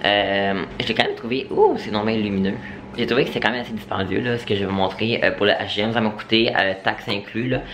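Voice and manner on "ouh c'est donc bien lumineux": high, startled little "ouh"; surprised exclamation. Real, not acted. Reacting to the bright light in surprise. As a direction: react as if blinded.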